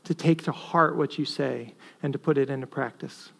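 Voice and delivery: soft, hushed prayer ending in amen; closing prayer. Acted. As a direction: pray quietly to close.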